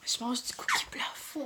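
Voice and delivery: unvoiced, hushed secret; whispered confession. Real, not acted. Mom whispers to viewers that she is eating cookie dough. Confiding a guilty treat.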